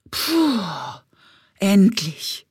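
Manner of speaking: a winded puh, then a loud endlich; relieved puff. Acted. Bumblebee arriving at the oak, out of breath and relieved. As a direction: blow out relief at last.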